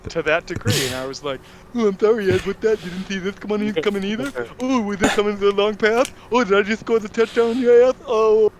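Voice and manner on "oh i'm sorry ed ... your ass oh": high, loud sarcastic taunting; retold gamer trash talk, pitched up; mock trash talk. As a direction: gloat with a fake apology. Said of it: "I started to talk shit to that degree".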